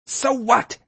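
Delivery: very high, shrieky outburst; high-pitched scream, lightly projected; screamed retort. A narrator's reading. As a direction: shriek it back at him. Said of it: "Ashley screamed".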